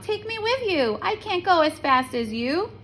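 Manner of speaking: high, pleading little frog. A narrator's reading. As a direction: beg her to wait, up high. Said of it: "cried the frog".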